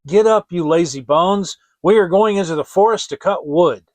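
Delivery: loud, bossy stepmother; harsh wake-up call. A narrator's reading. Stepmother waking the children, loud and bossy. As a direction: bark them out of bed.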